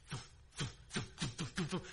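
soft, low thumps of distant feet; imitated running footsteps. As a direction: imitate muffled footfalls under your breath. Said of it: "the sounds of somebody running".

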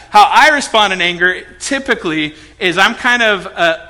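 ordinary talk

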